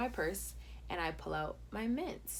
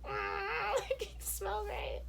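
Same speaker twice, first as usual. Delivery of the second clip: awkward squeal; 13 semitones higher, much slower than usual. Retold or imitated. Acts her squeaky awkward reaction.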